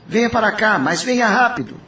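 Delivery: voz bem mais aguda, fingindo aflição ao telefone; falsa preocupação. Retold or imitated. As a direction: engana a prima com urgência fingida. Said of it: "eu lhe telefonei". (Armando ao telefone atraindo Julieta, aflição fingida)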